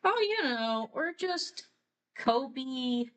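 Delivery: put-on high-pitched voice of young children; little kids' voice. Captive kids telling the scientist their names, high-pitched child voice.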